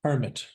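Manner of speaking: Permit stressed on the first syllable; Permit, strong PER falling away; noun stress. Shown as a demonstration. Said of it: "your pronunciation was for the noun".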